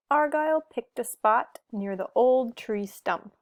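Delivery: plain reading voice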